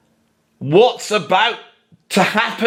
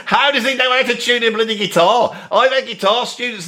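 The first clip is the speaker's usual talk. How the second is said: high, exasperated scoff; scoffing disbelief; 5 semitones higher than usual. Real, not acted. Reviewer scoffing at the Rush fans' excuse, exasperated. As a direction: protest it, half laughing.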